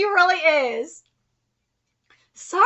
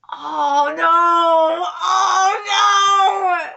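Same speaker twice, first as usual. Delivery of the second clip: loud, drawn-out wail of dismay; 3 semitones higher, 5 dB louder, much slower than usual. Real, not acted. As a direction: wail it out like a fan in despair. Dismayed reaction to the story.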